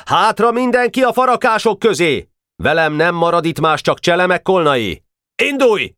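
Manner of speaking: high, commanding shout; giving orders. A narrator's reading. General Boka commands his army to retreat. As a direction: bark orders at the troops. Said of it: "És vezényelt".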